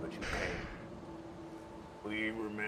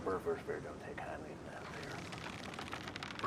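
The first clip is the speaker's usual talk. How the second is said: much lower, softer, slow growl; deep villain growl; 11 dB quieter, slower than usual. Acted. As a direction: rumble it like a monster.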